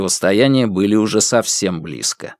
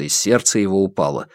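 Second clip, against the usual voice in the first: plain reading voice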